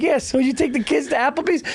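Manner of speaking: high, breezy small talk; chirpy off-mic chat. Retold or imitated. Voice actor chatting to colleague between grisly takes, breezy. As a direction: switch to light chit-chat, pitched well up.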